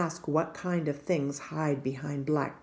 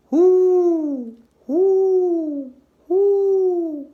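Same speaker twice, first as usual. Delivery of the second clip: loud, high hooting owl call; 13 semitones higher, 13 dB louder, much slower than usual. Acted. Pa calling to the owls in the woods, loud high hoots. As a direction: hoot like a great horned owl. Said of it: "The sound of a great horned owl".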